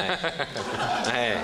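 normal talking voice

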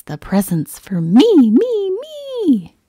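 high squealed greedy glee; gleeful. A narrator's reading. Greedy Pig crowing over his presents, gleeful. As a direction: squeal it, climbing high and loud on "me". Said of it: "He'd giggle with glee".

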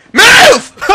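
real, high, loud yell; frustrated shout. Real, not acted. Player losing to supposed cheaters, real frustrated shout. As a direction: yell it in sheer game frustration.

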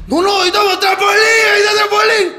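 high, imitated drunken insistence; mock desperate pleading; insistent protest. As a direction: protest in a high voice like a deluded fan. Uncle insisting song is playing.

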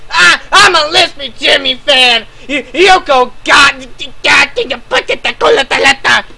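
high, loud yell dissolving into gibberish; mock raging fan. Imitating fans defending their YouTuber, mock shouting. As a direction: rant like a hammed-up, frothing fanboy. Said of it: "Y'all gonna be out there like".

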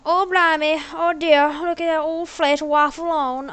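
exaggerated amateur Australian accent; bad Australian accent. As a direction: ham up a shaky attempt at an Aussie accent. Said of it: "I don't know Australian accent".

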